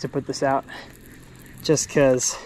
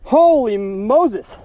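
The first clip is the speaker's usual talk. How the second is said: amazed; 10 semitones higher, slower than usual. Real, not acted. Landing a giant fish.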